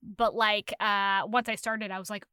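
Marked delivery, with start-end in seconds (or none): none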